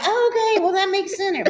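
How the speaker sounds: high-pitched mock agreement; mock understanding. Wife answering husband's corrected word, high mock agreement. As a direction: go way up high, as if finally getting it.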